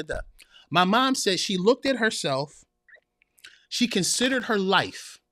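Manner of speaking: ordinary talk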